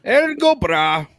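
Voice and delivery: high, loud hammed-up Irish toast; loud mock Irish cheer; stage Irish accent. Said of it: "What is happening?".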